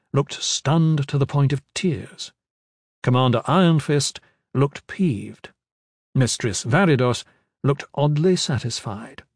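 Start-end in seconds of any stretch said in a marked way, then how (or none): none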